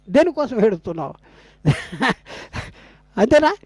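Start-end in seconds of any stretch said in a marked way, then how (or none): 1.6-2.1 short, dry chuckle; scoffing laugh. Real, not acted. Preacher to congregation after asking whom they weep for, scoffing chuckle. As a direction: laugh briefly at the absurdity.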